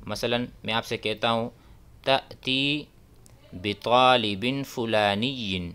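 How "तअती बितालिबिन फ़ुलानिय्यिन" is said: ordinary delivery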